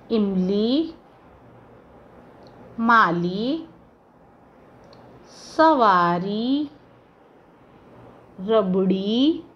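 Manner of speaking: slow words with long pauses between; dictation. Shown as a demonstration. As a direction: dictate word by word, leaving time to write. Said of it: "सिर्फ शब्दों को बोला जाएगा और आपको". (श्रुतलेख की तरह, धीरे और रुक-रुककर)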